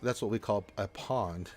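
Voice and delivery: ordinary delivery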